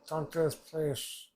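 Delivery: slow, loud desperate plea; a character begging someone to stop; loud pleading. Retold or imitated. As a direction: beg loudly and slowly.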